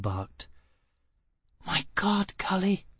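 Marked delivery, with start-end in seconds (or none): 1.6-2.8 higher, softer awed gasp; incredulous whisper. Acted. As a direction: breathe it in disbelief. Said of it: "Gisbella whispered incredulously".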